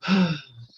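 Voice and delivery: a long sigh pushed out and let fall; an audible letting-go sigh that drops at the end; releasing sigh. Shown as a demonstration. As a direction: sigh the anxiety out of the body. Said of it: "release like you're releasing anxiety off of you".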